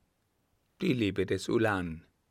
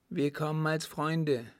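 higher-voiced young woman, reassuring; Adeline; 7 semitones higher than usual. A narrator's reading. Daughter approaches the captain as friend, reassuring.

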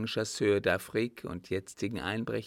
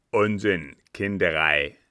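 loud scorn, dropping low at the end; contemptuous old captain; angry dismissal; 3 semitones lower, 10 dB louder than usual. A narrator's reading. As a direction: swat the idea away gruffly. Captain dismisses servant's explanation.

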